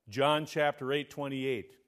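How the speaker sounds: ordinary talk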